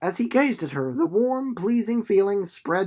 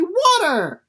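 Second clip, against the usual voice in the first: high, loud delighted exclamation; excited cry; 13 semitones higher, 8 dB louder than usual. A narrator's reading. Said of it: "cried Winnie".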